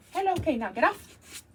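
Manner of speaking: ordinary delivery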